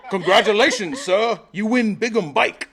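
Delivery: slow, loud, high mock Indian accent; mock Indian accent announcing the prize. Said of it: "I was gonna be like".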